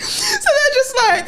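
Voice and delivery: squealing high laugh through the words; helpless laughter. Real, not acted. Telling friends about outfit, laughing hard. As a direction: barely get it out for laughing.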